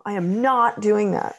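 an imitated shout at a child; loud yelling. Shown as a demonstration. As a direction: bark it like a fed-up parent. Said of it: "that kind of loud yelling voice".

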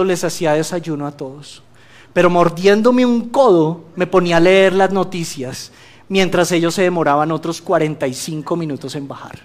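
ordinary talk

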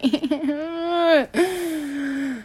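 a real laugh that cracks into crying. Real, not acted. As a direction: laugh through tears, breaking. Breaks down mid-rant.

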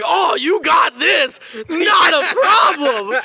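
high, loud, drawn-out mock cheer; gung-ho encouragement. Student hyping up a classmate at the eye wash, gung-ho. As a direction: cheer them on big and slow, high-pitched.

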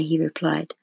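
plain reading voice

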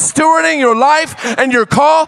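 full-force preaching. Acted. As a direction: preach it out to the whole church.

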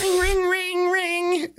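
loud, very high repeated ringing; imitated doorbell. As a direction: blare it out like an insistent doorbell. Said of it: "the doorbell goes again".

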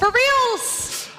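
high, loud exclamation: For reals!; emphatic confirmation. Real, not acted.

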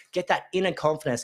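ordinary talk

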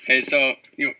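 ordinary talk

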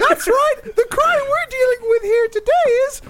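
very high squeaky cartoon mouse; Disney Mouse voice. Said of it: "been the Disney Mouse".

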